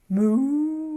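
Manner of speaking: a drawn-out pretend cow moo. Teacher mooing for the children, a playful cow sound.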